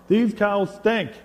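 slow, high, loud complaint; fed-up grandkid. Acted. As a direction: complain like a kid tired of the novelty. Grandkid fed up by fifth farm.

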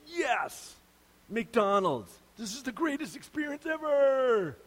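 a mock shopper, giddy with joy at McDonald's; overexcited. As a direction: squeal it like the happiest customer alive. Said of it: "just a little too excited".

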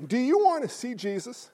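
normal talking voice

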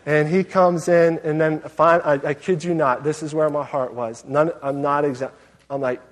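ordinary talk, nothing marked

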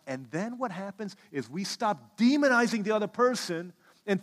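loud, fervent preacher voice; preaching. Acted. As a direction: preach it out, rising high. Preaching at full force.

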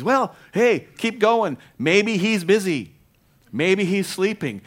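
high, loud, slow sarcastic jeer; mocking taunt. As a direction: taunt them like a smug heckler. Elijah taunting the prophets.

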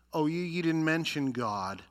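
ordinary talk

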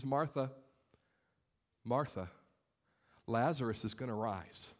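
low, soft, soothing reassurance; gentle consolation. Acted. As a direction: console a grieving friend, dropping the voice. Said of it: "He offers her words of consolation immediately".

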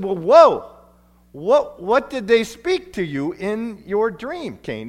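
loud high whoa, then slow question; surprised. Retold or imitated. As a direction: act amazed and curious. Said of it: "And I said".

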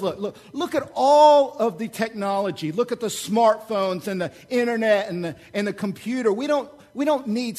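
mock the faithful as the proud modern world; hammed-up scoffing skeptic; voice of the culture. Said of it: "Our culture looks at us and says".

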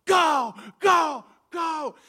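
high, loud chant of encouragement; shouted urging. Retold or imitated. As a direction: yell it like an eager contest judge. Judge urging the eaters on.

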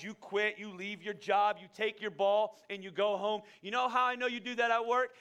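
pointed, emphatic preaching; preaching rebuke. Acted. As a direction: hammer each charge home.

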